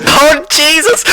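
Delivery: high shocked yelp into laughter. Real, not acted.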